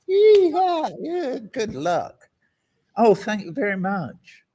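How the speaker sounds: high, loud mock cheer; mock elation. The poor answering the promise of heaven, mock elation. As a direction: whoop in sarcastic gratitude.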